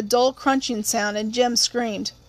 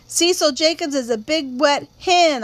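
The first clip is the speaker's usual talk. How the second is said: drawn-out, higher, taunting call into the dark; sudden taunting yell; 4 semitones higher than usual. Acted. Girl taunting the boy hiding behind them, slow yell. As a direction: yell slowly to show you know he's there. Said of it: "I yelled suddenly".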